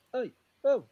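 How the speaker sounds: high, loud bar holler; calling for service. Retold or imitated. Drunk player hailing an ignoring barmaid, loud call. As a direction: shout to get noticed at a packed bar. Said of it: "so he's gone".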